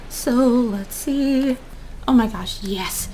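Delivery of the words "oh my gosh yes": usual speaking voice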